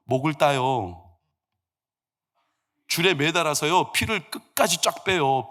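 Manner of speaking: ordinary talk, nothing marked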